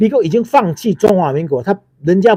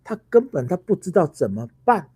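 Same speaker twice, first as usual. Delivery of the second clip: ordinary talk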